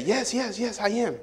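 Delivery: high, soft hurried yeses; eager liar. Acted. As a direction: insist a bit too eagerly. Jacob insists he is Esau.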